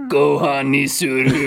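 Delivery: a grown man playing cute anime sister; anime little sister. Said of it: "that's my Emoto impression".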